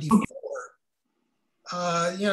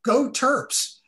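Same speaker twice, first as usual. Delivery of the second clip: higher-pitched rallying cheer; college cheer; 6 semitones higher, 4 dB louder than usual. Real, not acted. Repeating the college cheer to a fellow alum, cheering.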